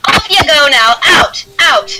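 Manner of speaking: high, loud shooing-out; dismissive dwarf woman. Acted. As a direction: order them out of the tavern. Dwarf dismisses the beaten men.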